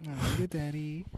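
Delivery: high, put-on flirty tease; mock seductive voice. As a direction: coo it like a hammy seductress. Jokey seductive reply.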